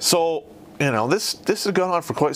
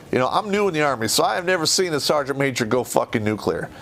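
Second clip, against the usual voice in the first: ordinary delivery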